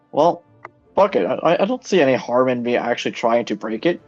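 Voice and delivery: normal talking voice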